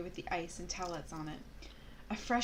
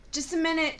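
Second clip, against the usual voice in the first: calling out; 8 semitones higher, 7 dB louder than usual. Acted. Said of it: "she called".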